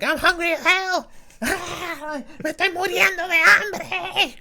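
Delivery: put-on high, loud squeaky baby voice with a laugh; voicing the baby. Dad voicing his hungry crying daughter, high and loud. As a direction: squeal it like a starving infant.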